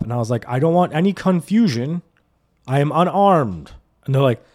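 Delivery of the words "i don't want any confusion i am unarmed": robotic compliance. Retold or imitated. Driver narrating his moves to cops, mock-formal announcing. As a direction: announce each move deliberately.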